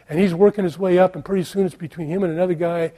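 ordinary talk, nothing marked

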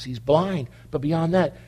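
normal talking voice

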